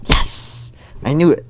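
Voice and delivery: loud, high gloating cheer; triumphant. Acted. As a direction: crow in triumph. Mai triumphs as Hana cries.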